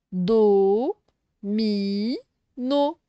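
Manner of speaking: "Do, mi, no" in separate held beats; teacher sounding out "Do, mi, no"; syllable by syllable. Shown as a demonstration. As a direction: pause after each of "Do", "mi", "no". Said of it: "on fait toujours les syllabes".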